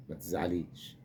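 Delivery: soft, mock-tender comfort to the crying girl; mock consoling. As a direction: soothe her in a teasing hush. (تطبيب خاطر ساخر بصوت خافت جدًا)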